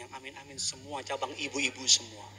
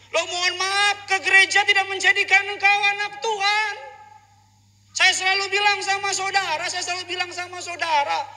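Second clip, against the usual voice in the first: high, loud preacher's shout; full-force preaching; 15 dB louder than usual. Real, not acted. Preacher admonishing the congregation about repentance, full-force preaching. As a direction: hammer it out at high pitch.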